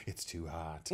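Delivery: hushed, low private dread; inner thought. Acted. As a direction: drop to a low, quiet aside. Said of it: "it was in their head being like".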